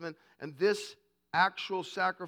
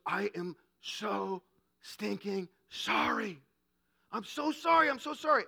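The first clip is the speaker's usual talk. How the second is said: pleading apology; 2 semitones higher than usual. Retold or imitated. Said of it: "and he goes".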